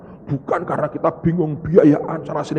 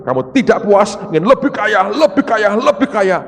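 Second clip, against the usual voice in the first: loud, high, driving preacher cadence; preaching at full force; 5 semitones higher, 5 dB louder than usual. Acted. Preacher warning the congregation about greed, preaching at full force. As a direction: hammer each repeated phrase home.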